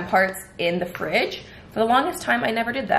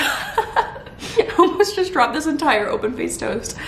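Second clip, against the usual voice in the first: slow, laughing through the words; giggling while retelling the near drop; 7 semitones higher, slower than usual. Real, not acted.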